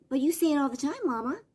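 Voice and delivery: put-on high little-kid Southern voice; imitated Southern toddler, high-pitched retort; Southern three-year-old. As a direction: talk back to Mama as a small Southern child. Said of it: "it was a really Southern accent".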